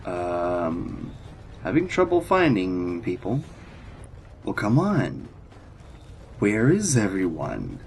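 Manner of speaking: usual speaking voice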